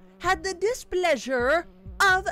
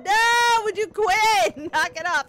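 high, loud pleading shout; exasperated yell; 7 semitones higher, 6 dB louder than usual. Real, not acted. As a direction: yell at it to stop. Yells at enemy.

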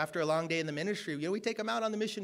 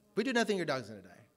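deadpan; 5 semitones lower, faster than usual. Retold or imitated.

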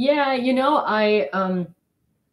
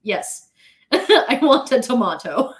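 slow, louder, half-laughing agreement; relieved American tourist; 3 semitones higher, 6 dB louder, slower than usual. Retold or imitated. American tourist finally understood at the sandwich counter, emphatic and laughing. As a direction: confirm it loudly and slowly, laughing. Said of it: "one American person was like".

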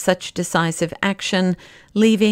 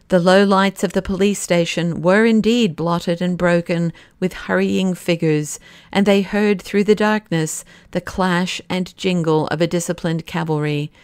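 plain reading voice

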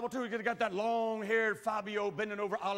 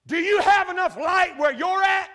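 loud challenge; 9 semitones higher, 11 dB louder than usual. Retold or imitated. Old man challenges the preacher.

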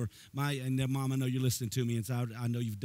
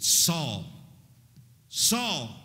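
Saul called out louder, falling at the end; divine voice calling; 4 semitones higher, 5 dB louder than usual. Acted. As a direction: call the name down from heaven. Voice from heaven calling out.

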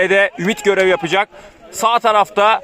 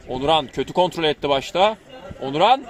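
ordinary talk, nothing marked

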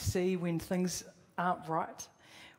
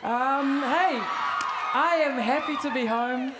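excited; 5 semitones higher, 6 dB louder, slower than usual. Real, not acted. Loud happy greeting on returning home.

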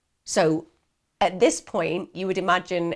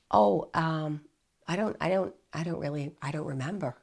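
much softer, fading hesitant mumble; mumbled evasive answer; 3 semitones lower, 6 dB quieter than usual. Retold or imitated. Erickson mumbling evasively to the police, soft and fading. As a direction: trail off into a vague non-answer.

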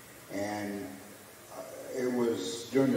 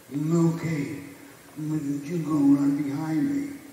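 higher, slow boxer's drawl; teasing Ali voice, pitched up; Ali impression; 7 semitones higher, 4 dB louder, slower than usual. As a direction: talk down to him like the champ.

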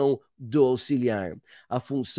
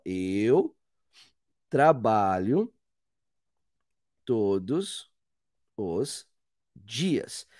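each word set apart with long pauses, lower pitch; slow word by word; 6 semitones lower, 3 dB quieter, much slower than usual. Shown as a demonstration. Teacher models a sentence for students, slow and separated. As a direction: spell the sentence out slowly for students. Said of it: "Então eu falo assim, por exemplo".

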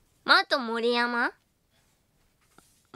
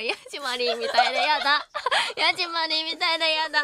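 laughing; 4 semitones higher, 3 dB louder, faster than usual. Real, not acted. Laughing at own performance.

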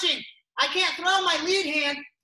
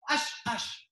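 exhaled punch hiss; 4 semitones lower, 5 dB quieter than usual. Shown as a demonstration. Breath sounds on punches.

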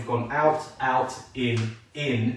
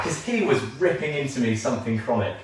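ordinary talk, nothing marked

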